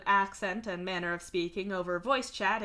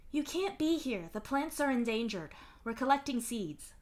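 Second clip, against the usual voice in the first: lightly voiced woman, a little higher; scientist woman; 4 semitones higher, 3 dB quieter than usual. A narrator's reading. Scientist explaining to a tourist, lightly voiced woman.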